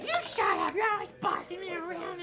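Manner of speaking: very high, loud goblin whine; quarrelling goblin. Acted. Bossed-around goblin to his buddy, whining squeal. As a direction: squeal back in hammed-up grievance.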